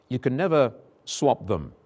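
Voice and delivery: ordinary delivery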